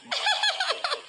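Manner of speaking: short high giggle, soft; child's giggle. Acted. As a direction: laugh at your own cheeky joke. Child laughing at joke. (लहान मुलाचे खुदकन हसणे)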